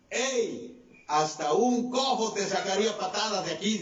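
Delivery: high mock yell from afar; retold taunting shout. Jebusites taunting David from the wall, high mock shout. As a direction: call out a jeer, pitched high. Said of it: "y desde el muro le gritaron".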